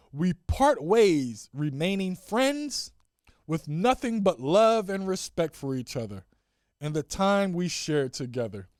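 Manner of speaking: slow, stretched, deliberate recital; mock-solemn announcement; statement reading. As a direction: savor each phrase of the official wording. Reads favorite part of statement.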